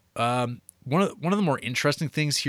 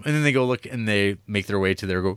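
normal talking voice

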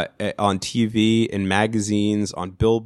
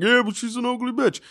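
imitating Richie; 11 semitones higher than usual. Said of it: "he would probably be like".

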